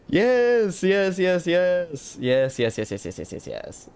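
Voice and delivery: gleeful, fading. Real, not acted. Streamer celebrating a birthday gift, gleeful then fading. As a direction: start high, tumble down and fade away.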